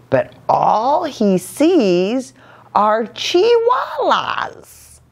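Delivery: high, slow mocking sister voice; sing-song tease, way up high; teasing sisters. A narrator's reading.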